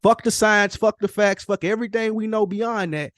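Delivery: normal talking voice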